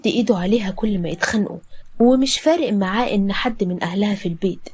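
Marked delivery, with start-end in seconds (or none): none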